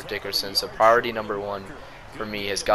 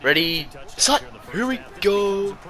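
Dak's cadence; 10 semitones higher, slower than usual. Retold or imitated. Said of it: "That's Dak's cadence".

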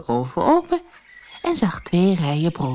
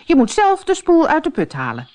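higher and a bit sharp, scolding; angry stepmother; 9 semitones higher, faster than usual. A narrator's reading. Stepmother scolds Floortje by the well, angrily. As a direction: order her to fetch it herself. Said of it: "Haar stiefmoeder werd boos".

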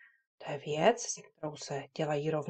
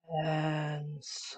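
slowly; 6 dB quieter than usual. Shown as a demonstration. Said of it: "řekneme si to pomalu. Slowly".

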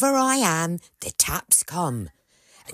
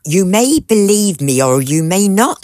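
louder, firm and insistent; emphatic insistence; 4 semitones higher, 9 dB louder than usual. Acted. Housekeeper insisting to a doubting master, louder and firm. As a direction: defy him to doubt it.

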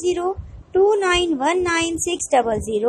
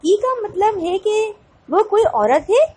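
higher-pitched, startled question; 5 semitones higher than usual. A narrator's reading. Sara Tom learns the agent is a woman, startled. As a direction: ask it as if caught off guard. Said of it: "سارا Tom نے چونک کر کہا".

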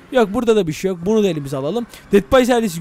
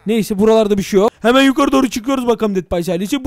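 normal talking voice

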